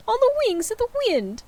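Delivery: high, hammed-up ghost voice; a wailing ghost warning Scrooge; ghost of Marley. Marley's ghost warns Scrooge in high hammed-up voice.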